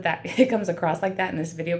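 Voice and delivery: ordinary delivery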